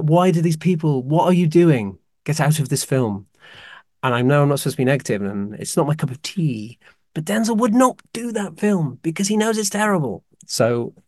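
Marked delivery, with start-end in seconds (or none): 0.0-3.3 raised, louder mock plea to the actors; exasperated rant. Film fan to actors in a terrible film, exasperated. 7.1-10.2 high-pitched, worked-up insistence; getting emotional. Real, not acted. Fan defending Denzel against terrible films, emotional. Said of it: "I got a bit emotional there".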